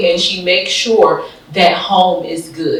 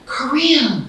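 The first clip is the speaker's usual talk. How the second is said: much higher, slow, cheerful encouraging voice; Susan cheering her on; 10 semitones higher than usual. Retold or imitated. Mimicking her leader reassuring her by text, high and cheery. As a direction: pump her up like a proud coach. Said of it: "And Susan was like".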